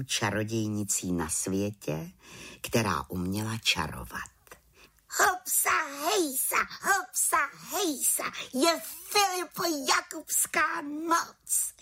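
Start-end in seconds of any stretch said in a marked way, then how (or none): 5.2-11.7 very high, jubilant witch chant; gleeful celebratory chanting; rejoicing. Acted. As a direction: cheer each word in a piping voice. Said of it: "jásala malá čarodějnice".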